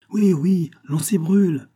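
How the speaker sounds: high, loud alarmed cry; terrified crowd. A narrator's reading. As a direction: echo the warning in alarm. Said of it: "répéta la foule avec terreur". (cri de foule effrayée)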